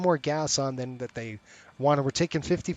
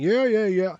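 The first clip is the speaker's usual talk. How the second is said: lightly mimicked dismissive teen, a bit higher; a breezy, couldn't-care-less yeah; teen brush-off; 5 semitones higher, 4 dB louder than usual. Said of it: "You know, she's fifteen".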